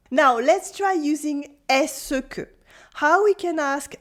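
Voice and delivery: normal talking voice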